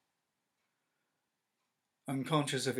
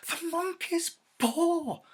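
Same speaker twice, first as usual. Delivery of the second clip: high slow anguished shout; wild cry; 9 dB louder, slower than usual. Acted. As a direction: call it out in wild hope. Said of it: "she cried wildly".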